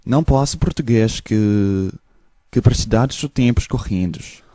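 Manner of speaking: Portuguese-sounding gibberish; fake Portuguese. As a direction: babble like Portuguese. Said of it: "now we do the Portuguese".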